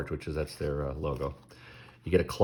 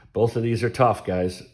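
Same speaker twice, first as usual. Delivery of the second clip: ordinary talk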